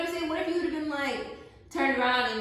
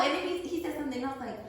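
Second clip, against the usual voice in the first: ordinary delivery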